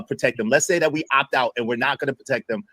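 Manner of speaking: ordinary delivery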